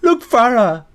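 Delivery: screamed high and loud; insistent scream. Acted. Father screams at Farah to look out the window, high desperate scream. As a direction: force her to look, distraught.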